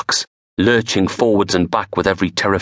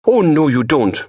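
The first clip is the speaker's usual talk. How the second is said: high, louder mock warning; teasing interruption; 8 semitones higher, 3 dB louder than usual. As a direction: cut in playfully. Samantha interrupting the couple.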